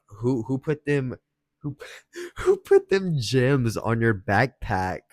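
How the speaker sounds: first retelling of her jealous question, slow and accusing; jealous girlfriend. Retold or imitated. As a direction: drag out the suspicious who like a jealous girl. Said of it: "I could tell she got jealous".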